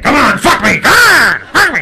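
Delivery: loud, urgent commanding cries; demanding woman. Retold or imitated. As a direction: demand it hard. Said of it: "She was like".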